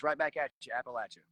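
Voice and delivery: Appalachia rhymed with "at you", æpəlæt; pronunciation tip. Shown as a demonstration. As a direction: say Appalachia like "apple at ya", teaching it. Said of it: "Think of it like this".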